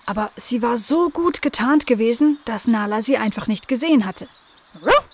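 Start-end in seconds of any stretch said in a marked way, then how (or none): 4.8-5.1 short voiced woof; dog bark. A narrator's reading. Garden dog Nala greeting the cat with a bark. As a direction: bark it like a friendly little dog. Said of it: "sagte Nala".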